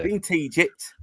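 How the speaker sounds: Yorkshire accent, rising at the end; Yorkshire dialect. Shown as a demonstration. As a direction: repeat "Been to Egypt" in broad Yorkshire. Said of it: "They say".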